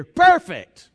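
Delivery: loud, high, dripping sarcasm "Perfect."; mock delight at a hopeless haul; sarcastic. Disciple mocking the tiny food supply, sarcastic.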